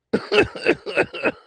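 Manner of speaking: high squealing laugh. Real, not acted. Streamer laughing at the clip with a high squeal.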